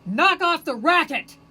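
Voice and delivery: high, loud, slow angry shout; mock yell. Snapping at the silent Buddha, loud mock shout. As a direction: roar it like a cranky neighbour. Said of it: "I would turn to him and go".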